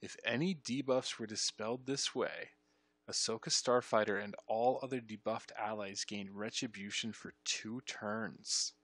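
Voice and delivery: deliberate reading with TURNS stretched out. Shown as a demonstration. Player re-reading the debuff text, slow and deliberate. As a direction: spell it out carefully, dragging the final words.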